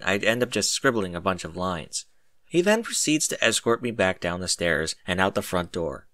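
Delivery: ordinary delivery, nothing marked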